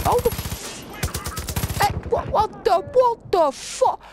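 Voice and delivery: high-pitched shocked cry, drawn out; real panic as he's jumped; startled yelp. Real, not acted. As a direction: caught off guard and squealing. Player ambushed in game, panicking.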